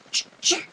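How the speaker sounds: sharp unvoiced 'ch, ch'; crisp imitated stapler clicks; stapler noise. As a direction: imitate a stapler punching twice. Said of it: "with the stapler".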